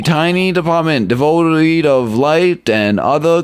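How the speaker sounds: high, pleading rookie cop voice; a jittery officer begging to be seen as the victim; nervous young officer. Acted. Officer begging the detective not to blame him, high and pleading. Officer describes his job.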